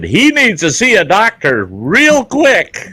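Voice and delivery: high, loud and slow, stressing real quick; urgent warning. Retold or imitated. Telling the receptionist about the bleeding man, urgent high call. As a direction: alarm her that he needs help now.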